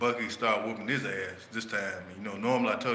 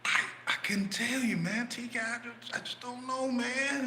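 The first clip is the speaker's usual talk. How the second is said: a higher, pleading put-on voice for the friend; whiny complaining friend; 13 semitones higher, 3 dB quieter than usual. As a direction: whine it like a beaten-down guy. Retold friend complaining, high voice.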